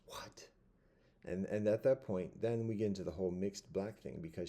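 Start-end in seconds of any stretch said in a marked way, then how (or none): 0.1-0.5 stunned, whispered reaction; hushed disbelief. Real, not acted. Reacting to a false claim about reparations, incredulous whisper.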